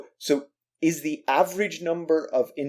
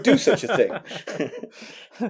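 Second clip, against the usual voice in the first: sarcastic; 10 semitones higher, much slower than usual. Real, not acted. Sarcastic reply about obsessed fans.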